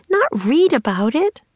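high, swooping objection; insistent girl. A narrator's reading. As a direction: object in a high little-sister voice. Annie argues with Jack.

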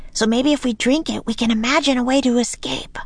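put-on high, quick girl's voice; bright-idea child, talking fast and high; eager young girl. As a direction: pitch it up and rush out the plan. Narrator voices the girl Annie.

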